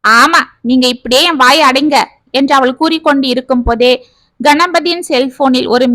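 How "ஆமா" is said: ordinary delivery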